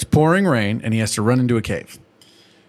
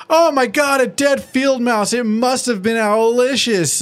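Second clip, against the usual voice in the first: much higher, slow, hammed-up gasp of discovery; mock shock; 11 semitones higher, slower than usual. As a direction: fake horror over a dead mouse, pitched way up. Acting Spike's fake discovery.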